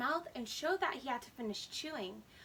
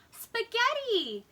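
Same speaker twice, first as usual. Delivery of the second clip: slow, much higher and louder shout of the word; excited announcement; 8 semitones higher, 6 dB louder, slower than usual. Acted. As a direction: show off the best dinner news. Arthur announces dinner.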